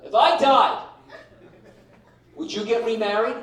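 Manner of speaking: high, loud nagging wife voice; a wife springing a loaded question; mock wife. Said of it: "She goes, uh".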